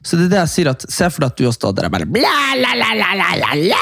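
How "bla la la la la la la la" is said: mock babbling. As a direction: imitate someone blabbering on, starting high and sliding down. Mimes someone babbling loudly away. (overdreven bablestemme)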